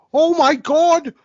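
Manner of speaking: a bad Boston accent in a shrieking broadcaster call; Susan Waldman Boston accent. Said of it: "that's a terrible Boston accent".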